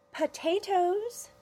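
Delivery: "Potatoes!" slow, high, rising; drawn-out high. Acted. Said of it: "she said".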